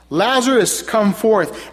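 loud cry. Acted. Jesus at the tomb calling Lazarus, a loud cry. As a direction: call him out of the tomb. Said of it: "he cried out in a loud voice".

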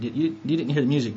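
ordinary delivery

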